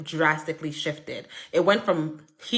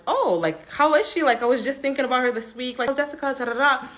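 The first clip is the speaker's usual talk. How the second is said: higher-pitched, animated catching-up voice; eager inquiry; 7 semitones higher, faster than usual. Retold or imitated. Teller replays asking a friend about Jessica, eager inquiry. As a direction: act out keen interest, pitched up. Acted reply on hearing of old friend.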